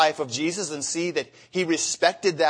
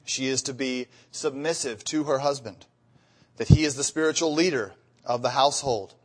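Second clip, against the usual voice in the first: usual speaking voice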